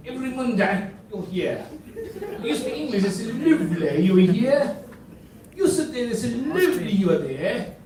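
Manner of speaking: playfully imitating a Cockney local; lightly hammed-up Cockney greeting; mock Cockney. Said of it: "an accent called as the Cockney".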